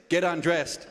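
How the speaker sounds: low, blunt command as the masseuse; masseuse's blunt order. Retold or imitated. Comic telling the crowd, imitating the masseuse's low blunt order. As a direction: bark it flatly like a curt masseuse. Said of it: "She goes like this".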